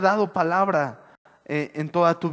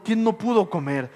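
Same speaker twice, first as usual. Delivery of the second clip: usual speaking voice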